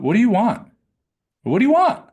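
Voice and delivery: "What do you want?" thrown up high and loud, rising on "want"; "What do you want?" squeezed high and bright, ending up; wrong high upshift. Shown as a demonstration. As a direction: ask "What do you want?" with a strained, lifted pitch, the wrong way.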